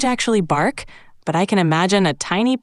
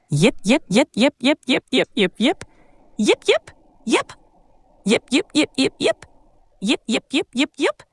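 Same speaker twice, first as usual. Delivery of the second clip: short rising yips in bursts, higher as they go; cute little dog yipping; 6 semitones higher than usual. Acted. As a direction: yip like an energetic little puppy. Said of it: "twenty times as a cute little dog".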